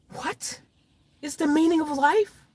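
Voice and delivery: whispered WHAT, then high rising question; incredulous echo. Acted. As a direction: repeat it high, as if baffled.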